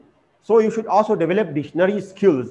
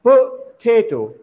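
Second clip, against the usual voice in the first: "potato" slowly split, weight on "ta"; a drawn-out "po-TA-to", higher pitched; stress on second syllable; 4 semitones higher, slower than usual. Shown as a demonstration. Teacher modelling potato for learners, stress on second syllable. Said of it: "you will pronounce this word as this".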